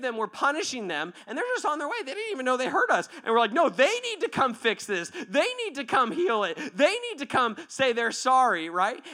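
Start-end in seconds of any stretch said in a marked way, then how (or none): 3.5-8.7 indignant demand. Acted. Acts out people demanding apology.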